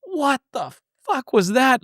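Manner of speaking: freaked-out cry. Retold or imitated. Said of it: "he freaked out".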